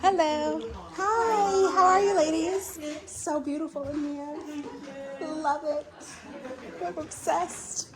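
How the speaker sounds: small, polite, high voice for the hosts; meek voice. Real, not acted. Greeting the gallery hosts in her home, meek and high. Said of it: "I hope you can hear the meekness in my voice".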